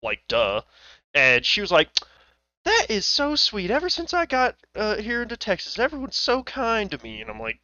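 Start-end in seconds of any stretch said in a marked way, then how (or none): 2.6-7.2 put-on high, gushing old lady voice; imitated old lady's high-pitched thanks; grateful old lady. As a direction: gush sweetly, pitched way up.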